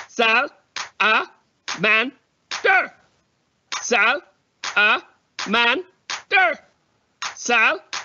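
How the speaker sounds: Sal-a-man-der. split into four even beats; syllables clapped out. Shown as a demonstration. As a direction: chant Sal-a-man-der. one beat per syllable. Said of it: "make a beat when I say each part".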